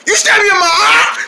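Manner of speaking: high, loud crying yell; wailing outrage. Acted. Hot dog wailing at the rabbit for stabbing him. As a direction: howl the accusation.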